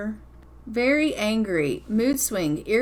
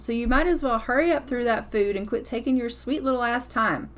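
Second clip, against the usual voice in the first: ordinary talk, nothing marked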